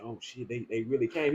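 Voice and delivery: very soft, low hushed voice; imitated whispering. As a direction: imitate someone whispering in alarm. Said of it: "He start whispering".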